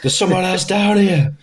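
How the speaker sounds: high-pitched calling out; mock alarmed call. Fireman calls up from basement.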